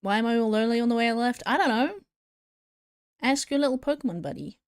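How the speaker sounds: usual speaking voice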